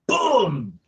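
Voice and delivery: high, punchy boom sound. Shown as a demonstration. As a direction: shout the boom sound with a fist pump. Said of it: "make the boom sound amplifies it".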